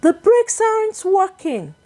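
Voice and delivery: high panicked shout. A narrator's reading. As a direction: yell the warning in alarm. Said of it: "The driver suddenly shouted".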